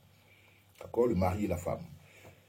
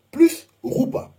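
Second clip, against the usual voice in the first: loud emphatic Roupa; 8 dB louder, slower than usual. Shown as a demonstration. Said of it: "teacher stressing final step".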